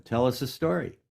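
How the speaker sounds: ordinary talk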